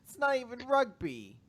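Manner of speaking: a hammed-up complaint; mock whine. Whining at bully.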